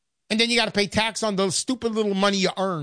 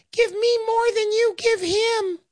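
much higher squeaky little voice, demanding; a greedy little man asking for more; tiny Uncle Sam voice; 14 semitones higher than usual. Retold or imitated. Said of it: "the little voice says".

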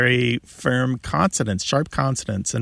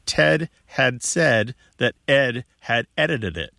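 slow, spaced-out words, each one distinct; slow and careful. Shown as a demonstration. Radio host presenting a hard tongue twister to listeners, slow and careful. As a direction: lay out the tongue twister word by word.

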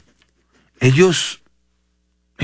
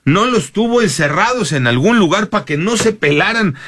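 Noroña; 6 semitones higher than usual. Retold or imitated. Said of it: "Noroña se enojó porque dijo".

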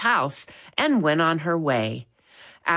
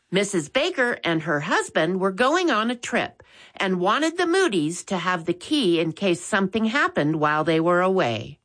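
usual speaking voice

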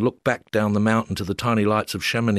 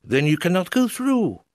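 obstinate Frenchman; 9 semitones higher than usual. Retold or imitated. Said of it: "said the obstinate little Frenchman".

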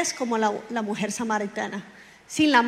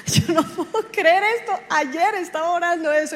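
laughing disbelief; 5 semitones higher than usual. Retold or imitated. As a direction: laugh through the words in disbelief. Amazed reaction to answered prayer. (riendo, incrédula y emocionada)

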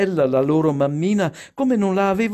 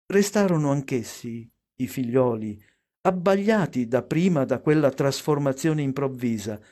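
plain reading voice

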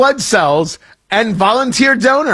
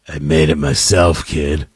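put-on very low, creepy grown-up to a child. Host handing a kid a blood bag, creepy. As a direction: drop right down into a sinister rumble. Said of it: "right amount of creepy in your eye".

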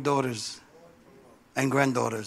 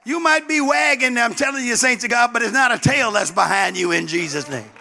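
loud, high, fervent preaching; preaching at full force; 5 semitones higher, 3 dB louder than usual. Real, not acted. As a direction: preach it hard to the congregation. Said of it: "let me calm down".

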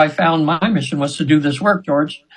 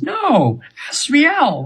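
much higher, insistent correction of the name; high angel correcting; 11 semitones higher, much slower than usual. Retold or imitated. Angel through client corrects the name, high and insistent. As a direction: play the angel setting her straight. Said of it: "and she says".

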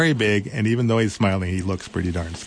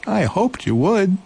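high, bright little mouse voice; eager little mouse; 11 semitones higher, 3 dB louder than usual. Acted.

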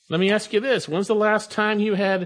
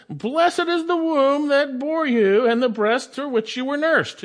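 high, sustained proclamation; raised voice; 10 semitones higher, 4 dB louder than usual. Acted. Woman in the crowd calls out to the Lord, raised voice. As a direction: call it out like a woman raising her voice in praise. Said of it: "raised her voice and said to him".

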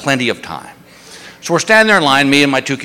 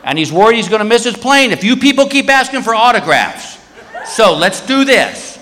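guard addressing the security line; guard announcing; 6 semitones higher than usual. Retold or imitated.

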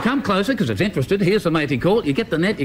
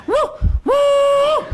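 very high loud whoop; mating call again; 3 dB louder than usual. Retold or imitated. Hunter retells calling again, very high loud whoops. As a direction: whoop the call once more. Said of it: "I did it again".

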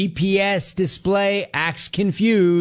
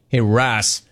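ordinary delivery

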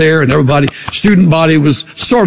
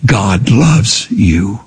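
tender emphasis; 6 semitones lower, much slower than usual. Real, not acted. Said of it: "You need to hear today".

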